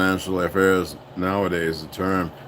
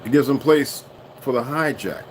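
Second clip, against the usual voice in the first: normal talking voice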